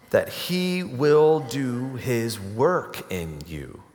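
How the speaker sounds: slow, drawn-out emphasis on "will"; stress on will. Shown as a demonstration. Preacher stating the first part of Jesus' promise, emphatic on "will". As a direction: lean slowly and firmly on "will". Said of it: "I want to emphasize that word".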